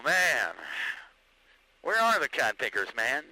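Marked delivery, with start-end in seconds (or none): none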